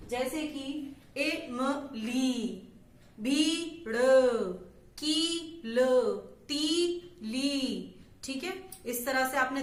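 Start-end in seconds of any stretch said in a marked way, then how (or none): 0.1-8.0 each ई drawn out very long, syllable by syllable; stretched long ई. Shown as a demonstration. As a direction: stretch every ई sound slowly: "मली", "भीड़", "कील", "तीली". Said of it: "उसे बहुत लंबा खींचते हैं".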